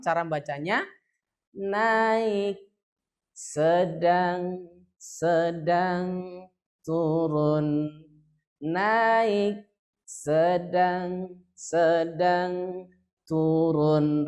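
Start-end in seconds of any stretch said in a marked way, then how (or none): 1.6-7.9 naik up, sedang held level, turun dropping; rising, level, falling tune. Shown as a demonstration. Teacher showing the class the four-column tune, rising, level, falling. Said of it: "Kalau empat kolom, cara bacanya". 8.6-14.3 naik up, sedang held level, turun dropping; rising, level, falling tune. Shown as a demonstration. Teacher showing the class the four-column tune, rising, level, falling. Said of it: "Rumusnya begitu kalau empat kolom".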